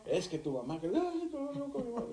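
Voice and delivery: hammed-up high-pitched fussing; mock a partner wailing over everything; imitated whining. Therapist mimics the wife who cries over everything, whining. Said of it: "por todo chilla".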